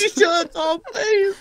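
very high squeaky begging. Retold or imitated. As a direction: beg like a starstruck little fan. Mocking fanboy begging for shirt.

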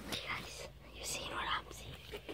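faint whisper to viewers. Real, not acted. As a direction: whisper while hiding from the seeker. Hiding, talking to camera.

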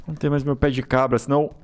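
ordinary delivery

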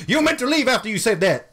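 high, strained imitation of an indignant man; mock indignant husband. Husband telling the visitor to get out, mock indignant high voice. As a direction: send up his outraged protest, pitch jumping up. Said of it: "And then he was like".

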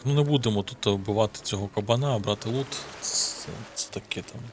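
normal talking voice

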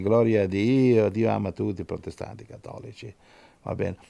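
ordinary talk

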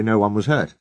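ordinary delivery, nothing marked

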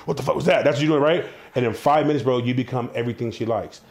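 ordinary talk, nothing marked

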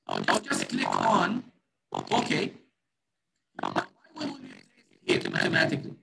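warbling, cutting-out mic audio; fluctuating voice. Real, not acted. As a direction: pitch dropping out on a bad connection. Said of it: "Sir, your voice is fluctuating".

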